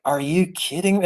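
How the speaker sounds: high, incredulous blurt; disbelief. Retold or imitated. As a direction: squeak it out, shocked at the dish. Hearing he must eat raw horse.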